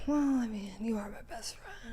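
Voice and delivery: very soft, voiceless whisper; hushed, breath-only aside to a friend. Real, not acted. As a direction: whisper it like a small confession. Confiding affection to the co-host.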